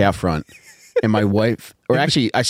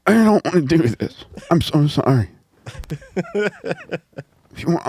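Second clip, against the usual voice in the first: lightly raised, hammed-up contrite wife; mock apologetic wife; 5 semitones higher than usual. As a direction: overplay a wife begging forgiveness. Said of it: "Your wife almost sounded like Peter Griffin".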